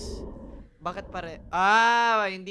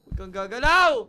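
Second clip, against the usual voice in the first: urgent shout; 3 semitones higher, 10 dB louder than usual. Real, not acted. Warning the monster.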